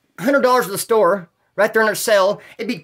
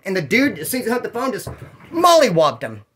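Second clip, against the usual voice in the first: ordinary talk, nothing marked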